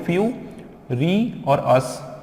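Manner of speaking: फ्यू, री, अस with gaps between; separated syllables. Shown as a demonstration. Teacher counts out the three parts, separated syllables. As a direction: set each piece apart: फ्यू... री... अस. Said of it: "तीनों को break किया".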